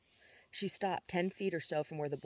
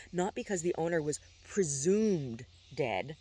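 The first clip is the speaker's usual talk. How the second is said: "presumed" drawn out slowly and leaned on; stress on "presumed". A narrator's reading. Johann bluntly challenging Chaz, heavy stress on "presumed". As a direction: hit "presumed" hard and stretch it. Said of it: "emphasizing the word presumed".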